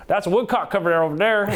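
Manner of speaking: high-pitched, quick Kentucky twang; hammed-up Kentucky holler; Kentucky accent. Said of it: "a Kentucky accent".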